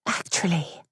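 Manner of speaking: a hesitant, soft voice. Acted. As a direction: barely dare to say it. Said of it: "Louisa's voice was so soft".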